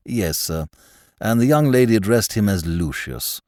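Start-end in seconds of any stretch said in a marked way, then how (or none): 0.1-0.7 slow, low, quiet manservant; measured, deferential valet; Jeeves, the butler. Acted.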